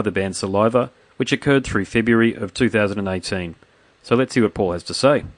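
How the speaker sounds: loud announcer read; podcast intro. Acted. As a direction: introduce the show like a radio host.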